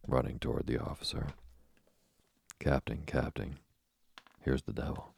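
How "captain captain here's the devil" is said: plain reading voice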